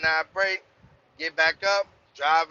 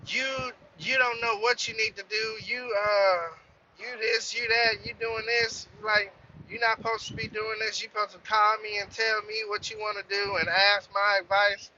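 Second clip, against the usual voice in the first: higher, heated scolding, imitating the dispatcher; mock angry dispatcher; 6 semitones higher than usual. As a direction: mimic her nagging at him, pitched up. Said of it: "She was like".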